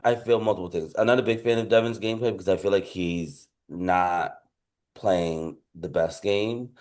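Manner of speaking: ordinary talk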